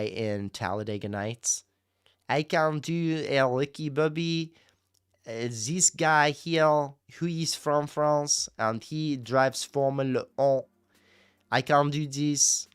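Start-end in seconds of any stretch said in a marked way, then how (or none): 2.3-12.7 put-on slow, thick movie Frenchman accent. Streamer doing the Talladega Nights guy, slow French accent. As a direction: draw it out in an exaggerated French accent. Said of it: "My only French accent is just the guy".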